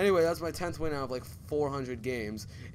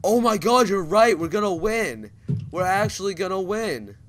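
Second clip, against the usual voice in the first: loud, high-pitched thrill; real excited outburst; 7 semitones higher, 8 dB louder than usual. Real, not acted. Player realizing they're gonna win, excited outburst. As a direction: burst out in excited disbelief.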